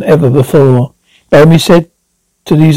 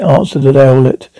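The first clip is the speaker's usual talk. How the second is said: ordinary delivery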